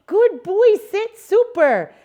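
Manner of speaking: put-on high, slow, sing-song "good boy"; praise voice. Shown as a demonstration. Trainer petting and praising the dog for sitting. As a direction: gush praise over the dog. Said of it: "This is me petting him up".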